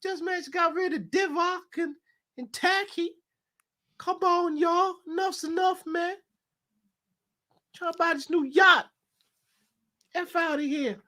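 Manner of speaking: high squeaky put-on owner voice, hammed up; J W Henry impression. Owner ranting about spending all his money, in a squeaky high mock voice. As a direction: whine about your money in a silly high voice. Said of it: "his J W Henry impression".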